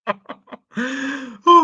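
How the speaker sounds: loud laugh. Real, not acted. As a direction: burst out laughing. Keeps laughing at clip.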